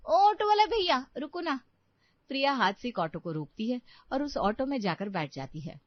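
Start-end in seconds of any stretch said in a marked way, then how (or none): 0.1-1.6 loud high call to an auto driver. Acted. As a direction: shout to stop the passing auto. Hailing an auto on road.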